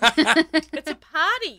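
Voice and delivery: slow, delighted drawl on party; savoring delicious food. Real, not acted. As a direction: stretch out it's a party with joy. Delighted after tasting.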